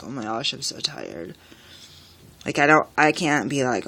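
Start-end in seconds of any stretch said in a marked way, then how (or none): 0.0-1.3 low, soft, worn-out voice; drained, the words sinking away; barely-there tired groan of a voice; exhausted sigh. Retold or imitated. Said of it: "but I'm like".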